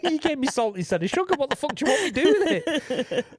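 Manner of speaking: high, laughing exasperated rant at a client; laughing mock rant. Producer mocks a client who sent salt, laughing rant.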